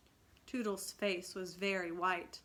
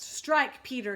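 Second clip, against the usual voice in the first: louder, steady and resolute; firm; 4 semitones higher, 9 dB louder than usual. A narrator's reading. Toodles baring his breast to Peter, firm and resolute. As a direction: offer yourself without flinching. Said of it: "he said firmly".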